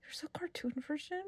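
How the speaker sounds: put-on hushed, whispery child's question; soft mock disbelief; little girl. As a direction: imitate an astonished kid, barely voiced. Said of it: "a conversation with a little girl".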